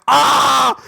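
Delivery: loud, high yell of fake pain; overdone cry of a player going down; mock scream. Soccer player faking hurt on the ground, mock scream. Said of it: "I started screaming".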